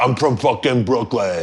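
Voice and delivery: slow, hammed-up New York tough guy; mock Brooklyn tough guy. Mocking a New York dude to the guest, slow Brooklyn accent. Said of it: "where he's like".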